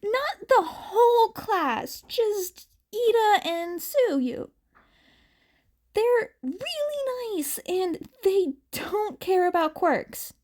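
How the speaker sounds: put-on high, slow girlish voice, reassuring; a girl easing her friend's worry; Uraraka adding quickly. A narrator's reading. Girl inviting nervous friend to meet classmates, high and gentle. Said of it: "she quickly added on".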